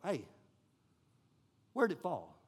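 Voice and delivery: quietly, almost under the breath; soft calm question. Retold or imitated. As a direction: ask it gently and low. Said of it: "His first response is".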